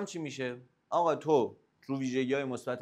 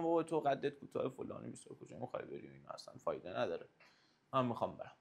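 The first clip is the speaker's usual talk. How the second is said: mocking; 4 semitones lower, 6 dB quieter than usual.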